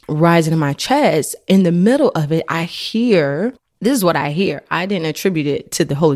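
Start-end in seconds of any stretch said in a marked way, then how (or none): none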